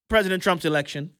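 ordinary delivery

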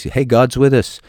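ordinary delivery

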